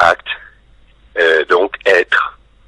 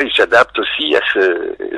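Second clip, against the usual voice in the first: usual speaking voice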